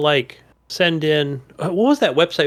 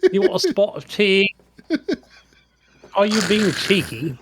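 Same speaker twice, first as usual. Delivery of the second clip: mock British accent; 4 semitones higher than usual. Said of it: "He has a British accent now".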